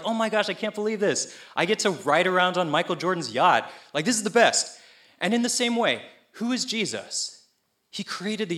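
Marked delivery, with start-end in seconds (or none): 0.0-4.8 high-pitched, thrilled gushing; overjoyed. Acted. Imagining a basketball idol's invitation to hang out, overjoyed. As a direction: act out a fan's giddy disbelief. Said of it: "I would be so happy".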